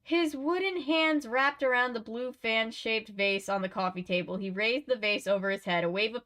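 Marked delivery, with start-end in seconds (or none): none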